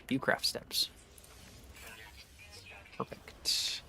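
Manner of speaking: soft unvoiced whisper. Real, not acted. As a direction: whisper to yourself. Whispered reading of menu.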